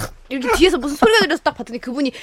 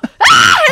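high, loud mock scream; short shriek of alarm; retold passenger's scream; panicked scream; 8 dB louder than usual. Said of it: "이제는 제가 막".

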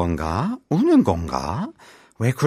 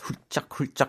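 soft sniffles; 4 semitones higher, 8 dB quieter, much faster than usual. Shown as a demonstration. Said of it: "that sniffle sound".

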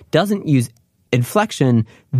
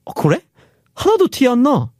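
high, lightly surprised coworker; mock surprise; 12 semitones higher, much faster than usual. Coworker reacting to bad day, mock surprised. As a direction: act amazed you couldn't tell.

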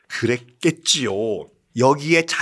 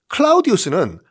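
normal talking voice

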